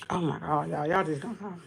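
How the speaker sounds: choked up. Real, not acted. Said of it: "I'm crying".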